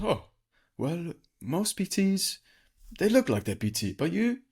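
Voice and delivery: high, slow Indian doctor voice; Indian accent. Retold or imitated. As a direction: imitate the Indian doctor, slow and sing-song. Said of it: "even funnier in his accent".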